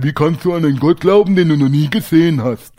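incredulous mock atheist challenge; imitated unbeliever's challenge; challenging atheist. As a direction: voice a sceptic pressing the question. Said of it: "sagen Atheisten oder Ungläubige zu mir".

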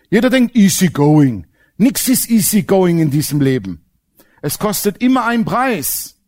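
usual speaking voice

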